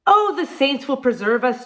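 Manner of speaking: put-on whiny lazy man moaning in an Irish accent; lazy Irishman whining to his wife. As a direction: whine it, lazy and sorry for himself. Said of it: "Jamie would whine".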